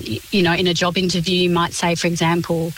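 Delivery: normal talking voice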